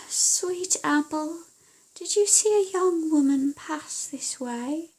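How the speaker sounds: slow, coaxing questioning; a hint of a sly daughter; sweetly asking the bee; lightly voiced witch's daughter.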